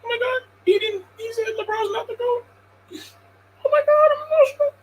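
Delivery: high, fake-weepy wail, fast at the end; mock emotional fan. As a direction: parody a fan breaking down in tears.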